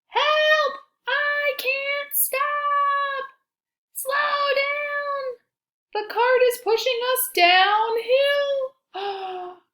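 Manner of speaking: very high, loud mock panic; panicked cry. Animals on a runaway cart, high panicked cries.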